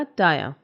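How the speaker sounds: "टायर" with the e barely there; light final vowel. Shown as a demonstration. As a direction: keep the end of "टायर" light. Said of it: "ज़्यादा नहीं आएगा". ("टायर" हल्के अंत के साथ)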